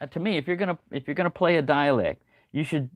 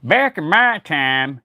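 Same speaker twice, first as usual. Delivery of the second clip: put-on loud, drawn-out grumpy old-timer; grumpy old guy; 3 semitones higher, 6 dB louder, slower than usual. Old guy ranting about grumpy stuff, grumpy and loud. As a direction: put on a slow, grumbling old man voice. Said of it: "it's just an old guy talking about grumpy stuff".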